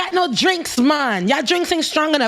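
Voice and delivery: high mocking voice, pushy and rowdy; mocking drunk tourist. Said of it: "She's mocking them like that".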